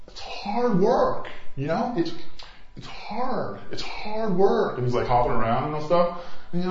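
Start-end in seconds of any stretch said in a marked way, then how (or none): 0.1-4.8 high, drawn-out Bush parody; Bush impersonator. Acted. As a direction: strain out slow, whiny complaints with halting restarts. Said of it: "The guy who does Bush".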